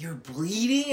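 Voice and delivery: usual speaking voice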